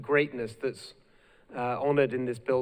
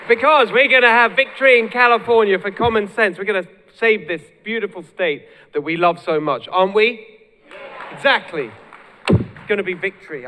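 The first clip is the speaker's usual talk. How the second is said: loud, raised-pitch rally speech; rallying cry; 5 semitones higher, 8 dB louder than usual. Real, not acted. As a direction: rouse the crowd toward victory. Rallying a cheering crowd to victory.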